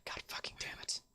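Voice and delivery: whispered curse under the breath; hushed, whispery swearing to oneself; muttering. Acted. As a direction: mutter it very low, almost all breath. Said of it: "you hear the girl just mutter".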